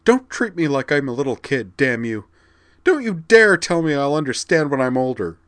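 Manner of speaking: spat. Acted. Vigdis, insulted, lashing out at Corwin. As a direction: spit the words at him. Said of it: "She wrenched herself ... and spat".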